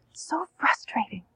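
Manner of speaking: soft whispered so frustrating. Shown as a demonstration. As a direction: whisper it soothingly to the child. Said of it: "what do you say".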